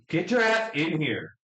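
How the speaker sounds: high, drawn-out call to a companion; playfully acted impatient call; in-character summons. Player calls to another character.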